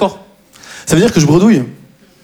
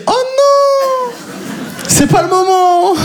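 high, slow mock panic; imitating his own inner panic at the door; 13 semitones higher, much slower than usual. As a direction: squeak of dread inside his head. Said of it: "je me dis".

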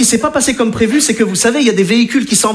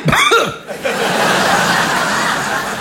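mocking laugh; 7 semitones higher than usual. Machine's mocking sound.

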